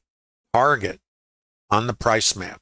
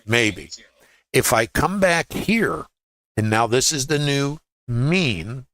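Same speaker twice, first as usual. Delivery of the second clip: ordinary talk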